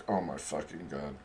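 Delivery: a quiet aghast aside to yourself; hushed disbelief. Retold or imitated. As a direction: breathe it out under your breath, stunned. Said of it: "and I'm just like".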